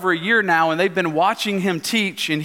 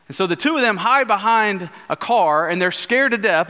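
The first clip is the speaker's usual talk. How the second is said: normal talking voice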